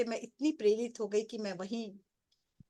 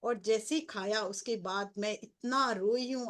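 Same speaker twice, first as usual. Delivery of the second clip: ordinary delivery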